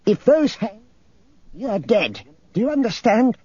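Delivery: put-on high, fierce old woman's voice; angry old woman laying down the law; snarling threat. A narrator's reading. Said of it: "he snarled at Nereus".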